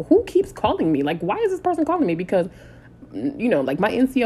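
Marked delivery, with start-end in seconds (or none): 0.1-2.1 higher, fast confused questioning; baffled at the phone ringing; puzzled. Retold or imitated. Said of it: "And I'm like".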